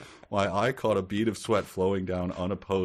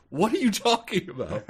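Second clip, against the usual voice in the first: high-pitched retold exasperation; indignant protest; 10 semitones higher, 5 dB louder than usual. Homeowner answering the meat guy's tantrum, indignant protest. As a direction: protest in disbelief, pitch shooting up. Indignant reply to huffy salesman.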